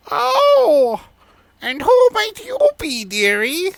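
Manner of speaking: put-on very high, creaky old granny; high, loud old lady voice; old woman voice. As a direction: put on a shrill old crone. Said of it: "Not the old woman voice".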